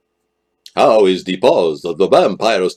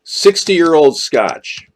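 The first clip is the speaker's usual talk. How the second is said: savoring slowly; 6 semitones higher, much slower than usual. Real, not acted. Savouring the whiskey name.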